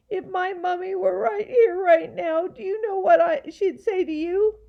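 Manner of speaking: put-on high, loud scolding girl; indignant child. A narrator's reading. Girl pushed too far by gaboons, indignantly scolding.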